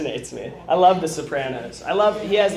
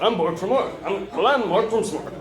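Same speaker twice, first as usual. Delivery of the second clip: high, goofy alien gibberish; zany Williams alien bit; Robin Williams alien voice; 5 semitones higher than usual. Retold or imitated. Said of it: "he's very like".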